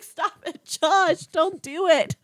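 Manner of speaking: high-pitched stressed plea; mock pleading. Viewer stressed for Josh, high pleading at the screen. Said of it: "I was like".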